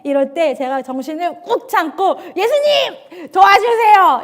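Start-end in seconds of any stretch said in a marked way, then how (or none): 2.3-4.2 high, pleading cry to Jesus; desperate prayer. Retold or imitated. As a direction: call out for help, pitched high. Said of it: "이렇게 기도합니다". (높고 간절한 기도)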